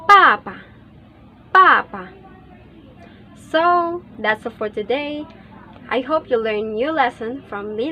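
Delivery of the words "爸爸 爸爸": "爸爸" sharp, loud falling tone on each syllable; fourth tone. Shown as a demonstration. Teacher reviewing fourth tone, forceful falling "爸爸". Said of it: "第四声".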